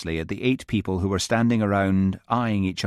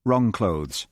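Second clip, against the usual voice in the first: ordinary delivery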